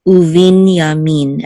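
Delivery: slow "Uvin yamin" landing on "min"; stress on MIN. Shown as a demonstration. Teacher sounding out the accent mark, final stress in "Uvin yamin". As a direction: lean on the last syllable of "Uvin yamin". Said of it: "the accent mark on min".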